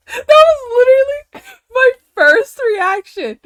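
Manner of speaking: high, loud, squeaky with laughter; helpless laughter. Real, not acted. Teller laughing at own first reaction, helpless. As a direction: squeal it while cracking up. Cracking up at own retold yell.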